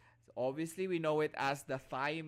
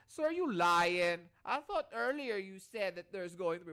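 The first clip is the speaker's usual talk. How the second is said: put-on Adrian's urban accent; 4 semitones higher, 6 dB louder than usual. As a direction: imitate a student questioning in an urban accent. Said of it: "very urban yung accent ni, ano, ni Adrian".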